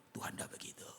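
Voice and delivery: hushed, breathy whisper of Tuhan tidak begitu; whispered aside to the congregation. Acted. Quiet repeat after emphatic line.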